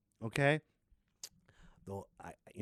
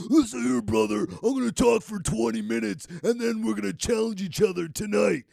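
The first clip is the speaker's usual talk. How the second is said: loud, higher hammed-up promo; wrestler promo; 7 semitones higher, 6 dB louder than usual. Hyping a match to the camera, loud wrestler promo. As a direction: cut a promo like a pro wrestler.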